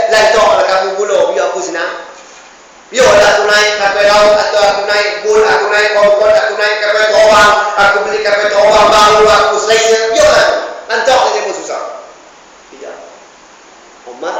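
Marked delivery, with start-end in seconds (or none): 0.1-11.8 loud, mocking boast of a ruler; mock a leader who doesn't care; a lightly voiced arrogant ruler; careless ruler.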